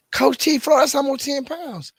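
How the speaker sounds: high, loud mock outcry of a viewer; shocked viewer. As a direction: play a viewer gasping at the number.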